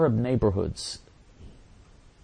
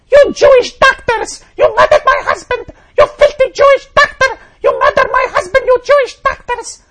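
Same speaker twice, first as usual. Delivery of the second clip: shrill, very high mock shriek at full volume; mock screaming; 12 dB louder than usual. Widow at husband's funeral blaming the doctors, shrieking mock scream. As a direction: shriek it like a hysterical widow, much higher and louder. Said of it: "she was screaming".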